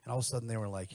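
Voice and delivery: usual speaking voice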